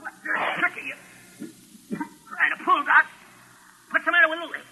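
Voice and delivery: high, weak, strangled gasps; choking on fumes. Acted. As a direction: fight for breath as the fumes take hold. Gangster overcome by benzene fumes.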